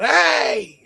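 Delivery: high, loud startled holler; startled yell. Retold or imitated. Startled man yelling at the creature, loud. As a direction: yell to scare it off. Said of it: "I actually yelled out".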